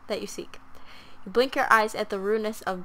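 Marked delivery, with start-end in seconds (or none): 0.1-0.6 unvoiced whisper of the line; a secretive whispered echo. Shown as a demonstration. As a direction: breathe it out as a whisper.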